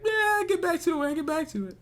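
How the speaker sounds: high-pitched mock brush-off; imitating someone putting it off; dismissive imitation. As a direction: wave it away in a high, airy voice. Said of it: "was like".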